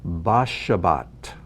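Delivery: "Bashabat" said slowly, stress on the last syllable; careful slow Hebrew; slow Hebrew reading. Shown as a demonstration. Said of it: "Bashabat means on the Sabbath".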